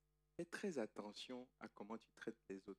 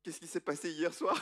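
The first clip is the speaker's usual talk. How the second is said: alarmed question; 5 semitones higher, 9 dB louder than usual. Acted. Jacob shocked next morning.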